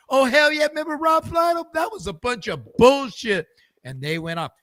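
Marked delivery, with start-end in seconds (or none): 0.1-3.5 high loud ranting. Retold or imitated. Players ranting about old calls.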